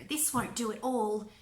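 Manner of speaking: usual speaking voice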